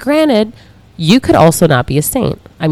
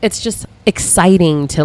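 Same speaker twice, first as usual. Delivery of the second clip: ordinary talk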